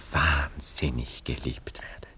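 awed, intimate whisper. Acted. Man telling his friend about that night of love, in a whisper. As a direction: breathe it like a secret you still marvel at. Intimate memory of being loved.

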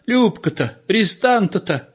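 put-on fretful old wife's exclamation; lightly voiced alarmed granny. A narrator's reading. Old wife at the window, lightly voiced fluster. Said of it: "всполошилась она".

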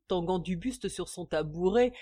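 ordinary delivery, nothing marked

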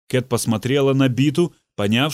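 ordinary delivery, nothing marked

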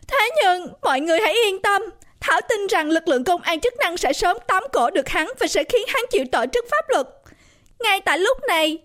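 high, emotional speech to a crowd; choked-up. A narrator's reading. Birthday girl thanking guests at the microphone, choked up. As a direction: speak through a welling lump in the throat. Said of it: "nói bằng một giọng nghẹn ngào".

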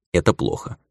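ordinary delivery

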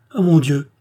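mother sees the wounded inspector, loud exclamation; exclaiming. A narrator's reading. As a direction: throw up your arms and cry out. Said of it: "s'écria madame de Coninck".

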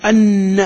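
"أن" drawn out on a doubled nun, then a; slow "anna" for "أن", leaning on the long n; نون مشددة. Shown as a demonstration. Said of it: "كيف تنطقها؟". ("أن" بشدة واضحة وغنة طويلة على النون)

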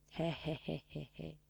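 low, soft dry chuckle; chicotit jucat. Acted. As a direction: chuckle quietly to yourself in character. Personajul chicotește ironic în poveste. (chicotit încet, prefăcut)

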